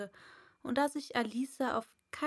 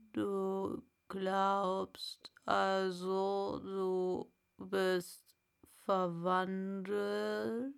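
very slow caterpillar; drawn-out, doubting caterpillar; Raupe; much slower than usual. Acted. Raupe voiced. (sehr langsame Raupe)

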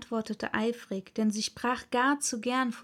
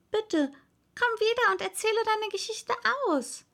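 calling after; 11 semitones higher than usual. A narrator's reading. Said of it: "rief Alice ihr nach".

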